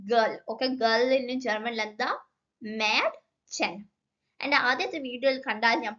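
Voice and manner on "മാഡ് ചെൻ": മാഡ് ചെൻ slowly, two parts; slow German word. Shown as a demonstration. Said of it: "pronunciation taught". (മാഡ് ചെൻ പതുക്കെ)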